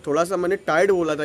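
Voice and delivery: ordinary talk, nothing marked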